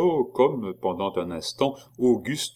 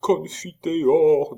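high, very slow drawn-out Confiteor; frightened prayer; 8 semitones higher, 4 dB louder, much slower than usual. A narrator's reading. The monk prays Confiteor out of fear.

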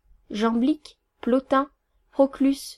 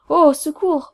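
loud, higher cry for help; mildly hammed-up alarm, voice raised; stammering marquis; 4 semitones higher, 8 dB louder than usual. A narrator's reading. Marquis startled by the nose, stammering loud alarm. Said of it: "bégaya le marquis".

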